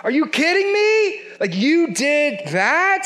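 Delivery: an apostle stunned by the church; outraged disbelief. Retold or imitated. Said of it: "There's some emphasis on this".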